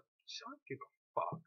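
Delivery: imitating Simone brushing him off, high-pitched; mock dismissive. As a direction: drag it out with contempt.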